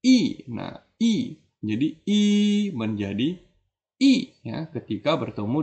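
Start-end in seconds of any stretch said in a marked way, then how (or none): 0.0-0.4 "i" falling sharply from high; fourth tone. Shown as a demonstration. Teacher showing the changed tone, "i" in a sharp fourth-tone fall. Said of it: "berubah bunyi jadi nada keempat". 4.0-4.3 "i" short and falling; fourth tone. Shown as a demonstration. Teacher showing what i becomes, "i" in falling fourth tone. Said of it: "berubah bunyi jadi nada keempat".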